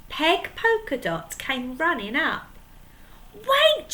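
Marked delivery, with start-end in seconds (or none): 3.3-3.9 much higher, louder urgent call; cry out. Acted. Peg Polkadot crying out to stop the pirates. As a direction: stop them with one cry. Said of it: "she cried".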